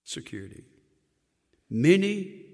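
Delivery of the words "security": even lower, softer repeat of Security; soft echo. Acted. Preacher repeating the key word softly. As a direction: breathe it out quietly again.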